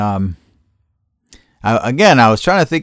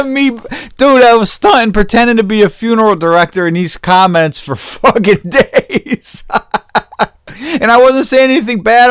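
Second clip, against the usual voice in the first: laughing while telling; 10 semitones higher, 5 dB louder than usual. Real, not acted. Telling viewers about fake funeral-director comments.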